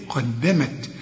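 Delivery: قدمت with the final dal bounced; qalqala on the final dal. Shown as a demonstration. Quran teacher to student: قدمت with a bouncing dal. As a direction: end قدمت on an echoing dal. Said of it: "إذا وقفت قلقل الدال".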